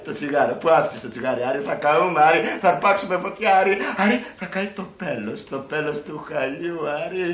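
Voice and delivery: hammed-up alarmed lady; film heroine. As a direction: imitate a woman fretting at her lover. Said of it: "Αυτή, Άρη".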